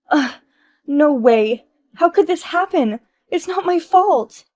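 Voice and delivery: high, loud whining despair; despairing wail. Acted. Wife wailing to her friend about paying the president. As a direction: wail that it's unfair.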